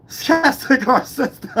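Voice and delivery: high-pitched, slow laughing delivery; real laughter taking over the sentence; laughing while talking. Real, not acted. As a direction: crack up as you say it, words dissolving into laughter. Laughs through his own remark.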